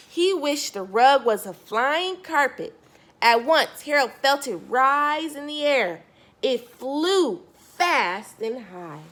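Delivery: put-on lively storybook narration for kids; storyteller reading Harold to a young listener; reading to children. A narrator's reading. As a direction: read it out like bedtime story time to a child. Reads picture book aloud.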